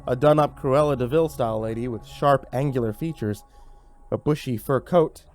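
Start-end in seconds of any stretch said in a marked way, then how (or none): none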